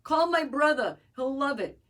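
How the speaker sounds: high, loud put-on elderly mother; mom impression. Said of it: "your impression of your mom is spot on".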